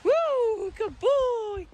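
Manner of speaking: high, drawn-out whoop of praise; excited pet-owner cheer; high dog praise. Real, not acted.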